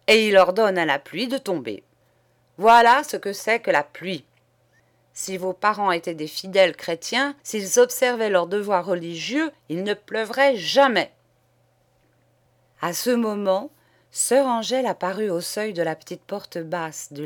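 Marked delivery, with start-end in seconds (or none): 0.1-11.1 put-on raised, emphatic sermon to children; preaching priest. Priest lecturing pupils about the rain, preaching fervently. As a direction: thunder at the little ones like a country priest.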